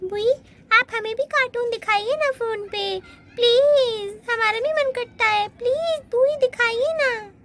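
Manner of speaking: put-on high begging little girl's voice; pleading child. Little girl begging aunt for cartoons, high whiny plea. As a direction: whine please like a small child.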